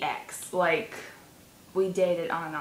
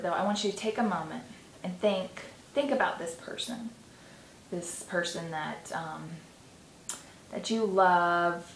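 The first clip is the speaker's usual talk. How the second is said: guided meditation; 5 dB quieter than usual. Acted. Leads a visualization.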